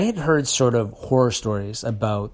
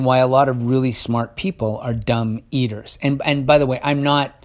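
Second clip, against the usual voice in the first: ordinary delivery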